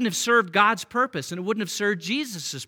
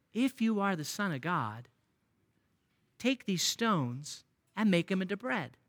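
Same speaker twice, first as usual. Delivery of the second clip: quiet, coaxing devil voice, much softer; sly tempting devil; 8 dB quieter than usual. Retold or imitated. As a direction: lure him gently like the tempter. Devil tempting hungry Jesus.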